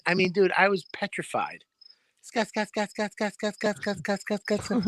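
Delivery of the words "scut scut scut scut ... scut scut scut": soft, higher repeated scut clicks; critter noise. Retold or imitated. Imitating a scary night noise, soft and higher. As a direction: tick it out quietly like something scuttling. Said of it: "what the fuck makes that noise".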